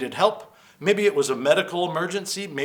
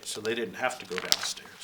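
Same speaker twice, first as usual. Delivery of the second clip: usual speaking voice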